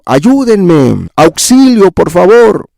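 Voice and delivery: high-pitched acted cry for help; grito de auxilio actuado. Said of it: "clama a voz en cuello". (clamor fingido pidiendo auxilio)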